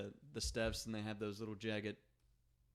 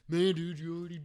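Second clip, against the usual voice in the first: retold friend; 6 semitones higher, 7 dB louder than usual. Friend voiced retelling.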